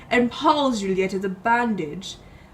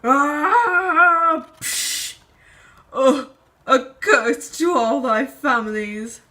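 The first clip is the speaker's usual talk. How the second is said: wounded dying cry; 3 semitones higher, slower than usual. Acted. Wounded character curses the families.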